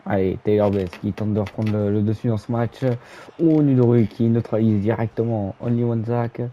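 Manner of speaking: live play-by-play call; wrestling commentator. Acted. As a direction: narrate the match as it happens.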